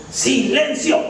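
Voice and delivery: sharp, high-pitched hush; stern command. Retold or imitated. Parent silencing a tantrumming toddler, stern high command. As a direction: snap a firm order at the child. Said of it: "y se les dice".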